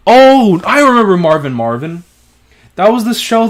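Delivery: sudden recognition. Real, not acted.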